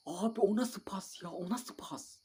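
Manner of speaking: can't believe that pass; exasperated. Real, not acted. Gamer fuming at his player's pass, high exasperation.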